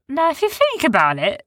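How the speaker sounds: Sniz's high voice. A narrator's reading. Sniz voiced in story.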